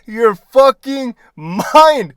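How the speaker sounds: mock-furious rant at a clueless lieutenant; mock outrage. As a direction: dump a disbelieving tirade on the lieutenant. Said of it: "I said in my head, I'm like".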